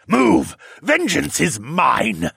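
loud, slow vengeful bellow. A narrator's reading. Wounded lord ordering someone aside, a bellow. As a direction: bellow it, stretching the words out. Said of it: "the Regent Lord bellowed at me".

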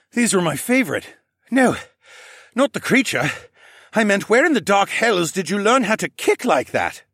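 animated, incredulous sidekick; excitable sidekick. Acted. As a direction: play an amazed adventuring companion. Plays Horace, the Falcon Man.

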